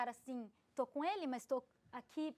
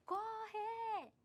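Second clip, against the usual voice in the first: imitated shout; 10 semitones higher than usual. Woman quoting the facilitator's cry, high stretched mock shout. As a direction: stretch it like a far-carrying cry, held back. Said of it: "Quando você gritou".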